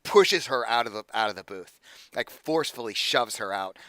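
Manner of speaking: ordinary talk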